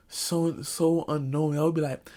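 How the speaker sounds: usual speaking voice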